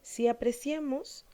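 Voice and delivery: usual speaking voice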